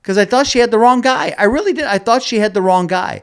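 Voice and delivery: high, loud disbelief; stunned. Real, not acted. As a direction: still reeling from the shock. Still stunned telling.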